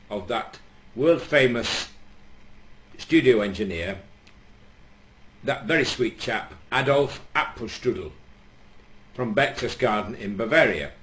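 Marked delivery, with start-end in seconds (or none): none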